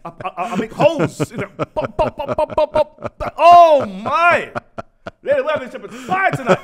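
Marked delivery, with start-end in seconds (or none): 1.6-3.3 high, playful pop pop pops; popping noises. Retold or imitated. Acting out piercing the pie lid, high playful pops. 3.4-6.6 high, loud, laughing announcement; excited announcing. Real, not acted. Announcing shepherd's pie to his lady, high and laughing.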